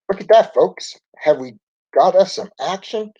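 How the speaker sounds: high-pitched on-the-spot reporter; news reporter voice. A narrator's reading. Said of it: "in his best news reporter voice".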